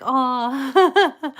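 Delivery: retold aw, loud and laughing. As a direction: retell the reaction with a big laugh. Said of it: "and I was like".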